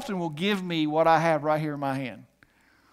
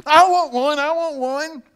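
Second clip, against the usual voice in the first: put-on high, louder childlike begging; child begging; 7 semitones higher, 5 dB louder than usual. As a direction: whine like a kid wanting candy. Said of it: "my son's back there going".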